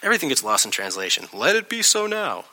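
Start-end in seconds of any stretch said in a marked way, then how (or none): 1.3-2.5 mock-gentle reading. Rereads translation before acting the fight.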